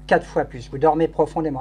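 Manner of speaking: hypnotic induction. Acted. Hypnotist leads trance induction.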